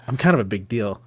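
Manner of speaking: low, understated joke brag; mock self-importance. Dad teasing kids about being recognized, mock self-important. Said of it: "and then I'll say".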